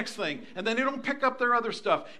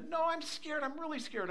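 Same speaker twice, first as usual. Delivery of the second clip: put-on higher, softer little kid voice; scared grandchild; 7 semitones higher, 5 dB quieter than usual. Scared grandchild answering grandpa at night, small high voice. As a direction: imitate a child insisting on being frightened.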